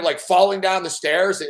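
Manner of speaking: ordinary talk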